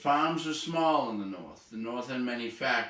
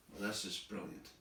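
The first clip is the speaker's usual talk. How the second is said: much softer, dry sarcastic aside; dry sarcasm; 3 semitones lower, 12 dB quieter than usual. Real, not acted. Teacher mocks the textbook's obvious line with muttered sarcasm. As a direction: toss it off under your breath, deadpan.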